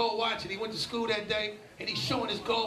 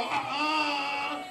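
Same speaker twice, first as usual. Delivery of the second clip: mock moan; 6 semitones higher than usual. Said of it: "make her go".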